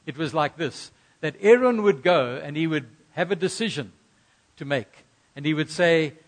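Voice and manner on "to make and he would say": normal talking voice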